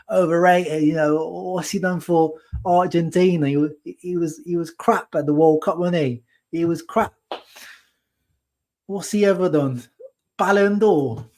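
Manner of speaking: high, put-on Cockney, scoffing at Lautaro; a smug London fan in a Cockney accent, pitched well up; mock Cockney fan accent. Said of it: "My accent sketches are great".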